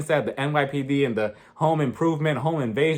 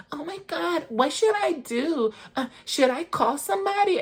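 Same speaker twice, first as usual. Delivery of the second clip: mock freak-out, Whitney; 13 semitones higher than usual. Recapper mimicking a housewife freaking out in the van, high-pitched. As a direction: imitate a helpless fluster, pitched way up. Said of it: "everybody's freaking out in the van".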